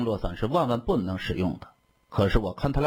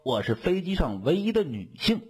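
lightly voiced smug young lady, higher pitch; 7 semitones higher than usual. A narrator's reading. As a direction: claim priority with higher, pleased self-importance. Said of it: "很是得意". (略带得意，音调偏高)

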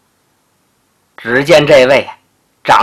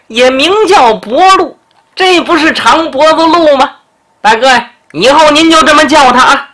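high mocking tease, rising as he goes; teasing; 7 semitones higher than usual. Feng Huan mocking his friend to the big brother, high teasing glee. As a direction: needle him, voice climbing with glee. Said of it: "这冯焕这嘴呀，的确够损".

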